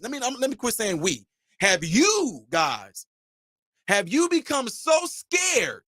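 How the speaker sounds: ordinary delivery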